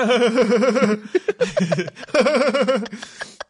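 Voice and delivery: loud, high mock laughter; hammed-up rolling laugh, loud and high; imitated laugh. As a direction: crack up at your own joke, exaggerated. Acting out own laugh at joke.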